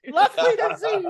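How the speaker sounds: high, loud laughing greeting; mock yell through laughter; yelling whale. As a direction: call out a jolly whale hello. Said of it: "the whales yelling back at you".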